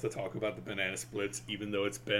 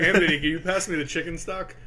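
higher, louder hammed-up host voice; mock cooking host; 7 semitones higher, 8 dB louder than usual. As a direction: play a cheery cooking show host. Mock cooking show dialogue.

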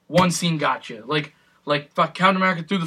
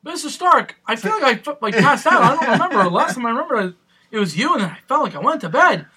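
put-on higher, boyish teen voice; imitating Spider-Man; 7 semitones higher than usual. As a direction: play a dazed young hero. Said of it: "quoting Spider-Man scene".

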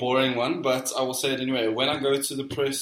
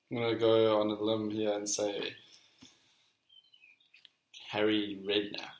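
normal talking voice

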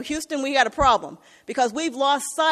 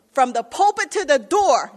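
rising to a loud preacher's cry; preaching at full force; 7 dB louder than usual. Acted. Preacher insists to the congregation that all have sinned, loud. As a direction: hammer it home to the pews.

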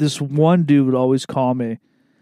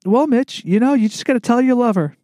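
higher-pitched coworker doling out advice; Brittany; 8 semitones higher than usual. Acted. Coworker advising a customer on love, imitated higher. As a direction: lift the voice into an imitation of a counseling colleague. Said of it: "It'd be like my coworker, Brittany".

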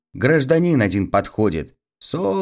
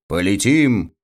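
usual speaking voice

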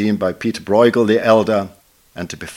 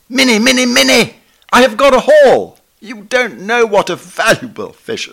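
much higher and louder, bursting with excitement; rapturous excited cry; 14 semitones higher, 9 dB louder than usual. Retold or imitated. Lindsay writing to his wife about his haul, rapturously excited. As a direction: call out to Minnie thrilled over a rare find. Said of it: "more rapturous reception".

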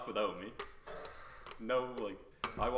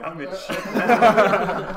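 laughing dread; 9 semitones higher, 16 dB louder, much slower than usual. Retold or imitated. Put in back of cop car.

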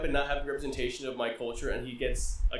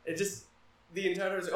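normal talking voice

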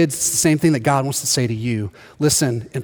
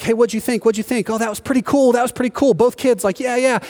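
excited chatter; 7 semitones higher than usual. Retold or imitated. Excited family after ride.

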